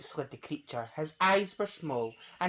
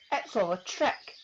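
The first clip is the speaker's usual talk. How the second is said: put-on higher child voice; defiant child; 7 semitones higher, 4 dB louder than usual. Gruffalo's child doubting, higher voice. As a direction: scoff at the story.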